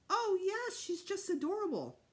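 high-pitched cooing admiration; polite company fawning over baby; gushing visitor. A narrator's reading. Visitor answering boy about baby, gushing.

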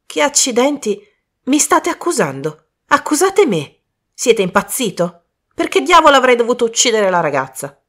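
high, indignant outburst; acted fury at being accused; outraged protest. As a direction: protest loudly, rushing into the last question. Outraged man denies the accusation.

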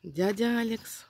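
soft whisper, slowed. Real, not acted. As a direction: breathe the name quietly. Whispers lion's name. (шёпотом)

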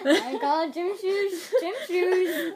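laughing through the words; slow and giggly. Real, not acted. Laughing at silly question.

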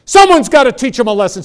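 loud, high, indignant outburst; retelling the angry reply to his mentor; angry retort. Retold or imitated. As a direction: snap back, fed up and vengeful. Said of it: "And then I got ticked".

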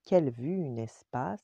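ordinary delivery

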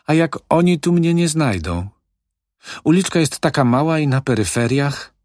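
put-on high, anxious old woman; suddenly worried. Old widow suddenly afraid they won't be found, anxious and high. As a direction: voice jumps with sudden alarm. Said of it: "zaniepokoiła się nagle stara".

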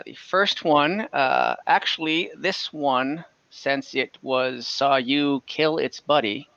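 normal talking voice

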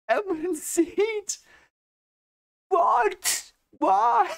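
very high, squeaky disbelief, slowed on the first words; baffled Matt. Retold or imitated. Matt squeaking in disbelief at Rand, very high. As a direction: shriek the questions like he can't believe it. Matt's baffled reaction voiced.

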